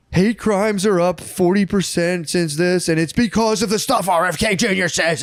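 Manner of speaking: imitating a preachy congresswoman, sing-song; mock congresswoman. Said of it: "being like".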